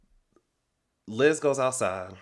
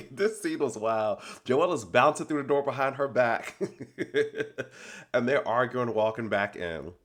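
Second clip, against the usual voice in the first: laughing; 3 semitones higher than usual. Real, not acted. Laughing while describing.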